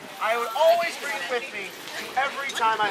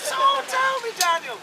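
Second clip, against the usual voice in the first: high-pitched Irish grandmother; acted gran, starting high and coaxing; Grandma Rose; 4 semitones higher, 4 dB quieter than usual. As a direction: quiz him in a lilting granny voice. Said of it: "she goes".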